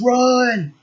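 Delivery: retold yell. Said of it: "He goes".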